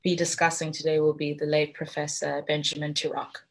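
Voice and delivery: usual speaking voice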